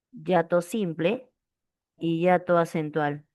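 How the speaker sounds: Hiato simple y hiato acentual said slowly; slow dictation. Shown as a demonstration. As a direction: dictate each term slowly. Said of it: "repeats terms for dictation".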